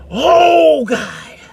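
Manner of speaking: a high, loud yelp on "Oh", then a loud whine; sudden dismay. Retold or imitated. Facing the casting director, nervous stomach, loud comic dismay. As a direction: blurt it out in comic panic. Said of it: "And I'm like".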